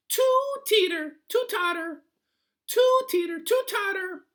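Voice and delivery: very high, loud squeaky complaint; a fussy little sprite shrieking its gripe; high squeaky goblin whine; tiny creature voice. A narrator's reading. Hudgeon complains about the bed.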